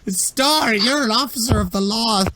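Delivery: high wailing cry for help, hammed-up; pained desperate plea. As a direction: wail to an officer to save you. Injured victim cries for help.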